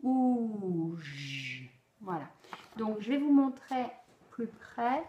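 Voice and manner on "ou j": "ou" then a long low voiced "j"; vowel then j. Shown as a demonstration. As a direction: add the forgotten "ou j" pair. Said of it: "j'ai peut-être oublié".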